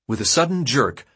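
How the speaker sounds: usual speaking voice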